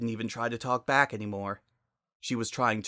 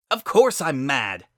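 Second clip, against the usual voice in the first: much louder angry shout; 4 semitones higher, 11 dB louder than usual. Acted. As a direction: bark it back. Shouted reply in quarrel.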